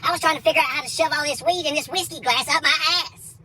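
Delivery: normal talking voice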